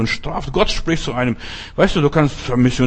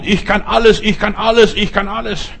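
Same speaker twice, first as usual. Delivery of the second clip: mock boastful preacher, higher and louder; 8 semitones higher, 3 dB louder than usual. Preacher mimics evangelists who claim they can do everything, mocking. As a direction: parrot the evangelists' bragging. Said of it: "die immer behaupten".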